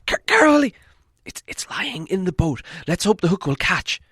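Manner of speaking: very high, loud, urgent cry; excited hobbit. Acted. Hobbit watching the hook land, very high excited. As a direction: gasp it out, hopeful and tense.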